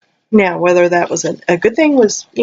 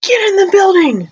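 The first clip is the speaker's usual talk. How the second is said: higher, urgent call to the others; urgent shout; 12 semitones higher than usual. Retold or imitated. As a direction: yell them into the building. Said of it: "he's like".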